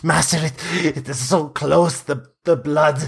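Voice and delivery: high, pleading and wretched; a starving vampire begs his master for release; starved pleading vampire. Acted. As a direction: beg like a starving creature locked away. Spawn smells blood.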